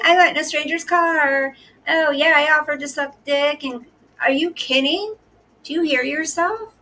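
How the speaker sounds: high, whiny mock voice; mocking a friend's story in a high whiny voice; mocking impression. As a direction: keep the high victim voice while mocking her story. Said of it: "That was a pretty good impression".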